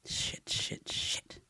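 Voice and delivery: tense whispered swearing; whispered cursing. A narrator's reading. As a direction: hiss the curses under your breath. Panicked curse under breath.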